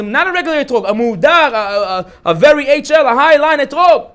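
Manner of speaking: fervent sermon climax; rising fervor. Real, not acted. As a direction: build it up with excitement. Rabbi preaching, rising excitement.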